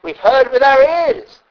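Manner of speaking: a preacher exclaiming "heard with our ears"; emphatic exclamation. Shown as a demonstration. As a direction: proclaim "We've heard with our ears" with wonder. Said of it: "we express it like this".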